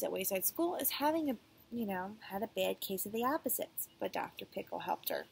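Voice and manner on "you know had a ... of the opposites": usual speaking voice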